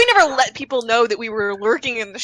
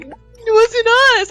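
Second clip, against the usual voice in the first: put-on little girls' denial; 13 semitones higher, 6 dB louder, slower than usual. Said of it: "And they were like".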